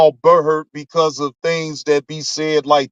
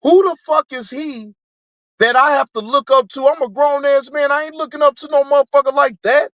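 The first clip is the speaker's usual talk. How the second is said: much higher, outraged and incredulous; indignant disbelief; 8 semitones higher than usual. Retold or imitated. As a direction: rant it up high, offended. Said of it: "I'm thinking to myself".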